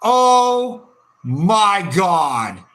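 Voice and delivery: very slow, drawn-out groan of shock; slow disbelief. Real, not acted. Fan stunned by the miss, slow disbelieving groan. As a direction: let the shock stretch out.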